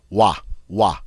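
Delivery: "Wa" as at the start of watch; two separate open "wa" syllables; wa sound. Shown as a demonstration. Teacher demonstrating French O I, wa sound. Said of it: "is like the wa sound in watch".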